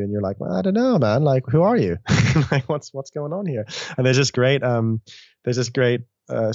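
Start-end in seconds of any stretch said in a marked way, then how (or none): 0.4-3.7 higher, incredulous mock questions; mock startled tripper; wary disbelief. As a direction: push back on a strange being, half laughing. Wary reply to a psychedelic entity.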